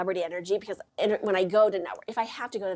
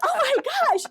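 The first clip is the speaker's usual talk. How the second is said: high delighted squeal; 5 dB louder than usual. Retold or imitated. Inner squeal at being seen.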